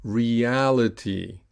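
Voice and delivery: slow "Reality", both E and A clear; "Reality" with a long, stressed second vowel; repeat-after-me model. Shown as a demonstration. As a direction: stretch "Reality" as re-AL-ity. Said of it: "So repeat after me".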